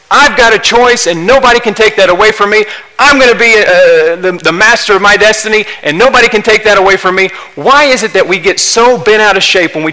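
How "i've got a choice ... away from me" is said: loud, higher mock boast of human pride; defiant boast. Preacher mimicking a defiant sinner, raised and fast. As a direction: throw out the proud claim, ringing.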